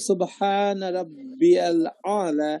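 Subhan রাব্বিয়াল আলা drawn out slowly; slow recitation. Shown as a demonstration. Teacher recites the Arabic phrase slowly for students. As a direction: recite it slowly, each sound held. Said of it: "recites the phrase slowly".

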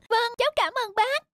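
high kitten voice; little kitten. Acted. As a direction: explain anxiously like a small kitten. Kitten Mít voice.

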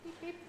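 high little Beep, beep like a mouse; mouse squeak. Retold or imitated. Minister imitates the Dutch mouse's squeak, high and soft. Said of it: "the Dutch mouse says peep".

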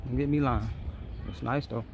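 normal talking voice

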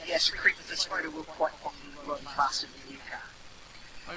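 hushed, breathy whisper. Real, not acted. Unvoiced whisper measured.

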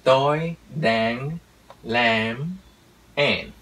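put-on slow American accent, every final consonant drawn out; American-accented Vietnamese. Teacher imitates an American speaking Vietnamese, slow American accent. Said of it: "người Mỹ nói tiếng Việt theo cách của họ".

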